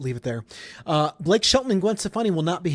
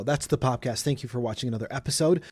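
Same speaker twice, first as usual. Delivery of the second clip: usual speaking voice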